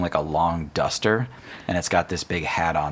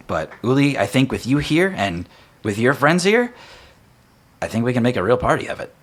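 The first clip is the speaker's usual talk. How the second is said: raised, brisk friendly NPC; upbeat host welcoming help; lightly voiced cheerful guard; 5 semitones higher, 6 dB louder than usual. Guard explains the town's troubles, bright and upbeat.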